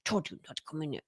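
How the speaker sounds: mean snap. Retold or imitated. Mother scolds her child mid-training, mean and hushed. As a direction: hiss it at the kid meanly. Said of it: "like, mean".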